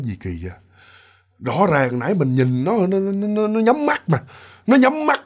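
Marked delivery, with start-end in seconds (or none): 0.0-0.5 spooked murmur. Retold or imitated. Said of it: "nổi da gà hết trơn".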